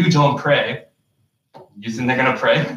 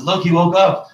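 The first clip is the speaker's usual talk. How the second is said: higher, louder bright pretend voice; playful pretend parent voice; mock cheerful fib; 6 semitones higher, 4 dB louder than usual. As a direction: fake cheer to fool a child.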